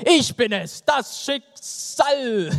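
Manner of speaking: slow, drawn-out high reveal of the name; dramatic reveal. Acted. Fate revealing its name to Till, slow and grand. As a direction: stretch the words out like a grand unveiling.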